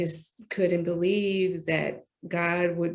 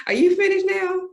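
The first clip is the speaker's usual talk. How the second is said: much higher, louder, laughing through it; laughing God voice; 13 semitones higher, 8 dB louder than usual. Retold or imitated. God teasing the speaker mid-laugh, high and laughing. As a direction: laugh as God teases her. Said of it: "he was like".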